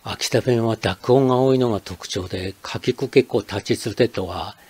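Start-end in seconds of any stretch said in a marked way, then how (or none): none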